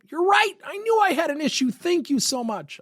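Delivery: very high, gushing fake gratitude; hammed-up delight at being criticized; mock grateful agreement. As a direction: play an absurdly thankful employee, voice way up high. Sarcastic example of John gratefully agreeing.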